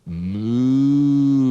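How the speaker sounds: a loud, high, long moo; cow moo. Pretend. Teacher mooing like a cow for the children. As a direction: moo like a cow to delight kids. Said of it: "A cow".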